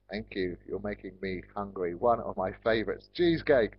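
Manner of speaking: ordinary delivery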